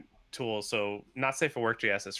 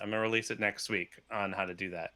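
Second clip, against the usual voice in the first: ordinary talk, nothing marked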